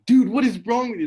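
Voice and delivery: loud high outraged call; classmates' outraged shout. Retold or imitated. Gym classmates shouting at him, loud and high. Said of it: "everyone's like".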